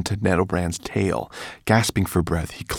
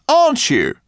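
raised, loud excited voice; professor voice; 8 dB louder than usual. A narrator's reading.

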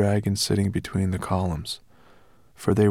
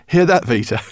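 loud, high and thrilled; delighted call; 9 semitones higher, 10 dB louder than usual. A narrator's reading. Professor to his wife with happy news, delighted call.

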